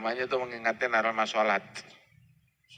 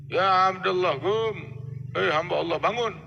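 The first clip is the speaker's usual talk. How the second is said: raised, high call to wake the sleeper; angel calling out; 6 semitones higher than usual. Acted. Angel wakes the dead man.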